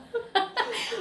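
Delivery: high cackle. Real, not acted. Cackling about watching it later.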